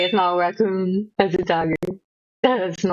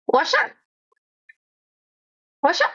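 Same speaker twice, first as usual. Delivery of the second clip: high cartoon voice; 10 semitones higher than usual. Trying character voice lines.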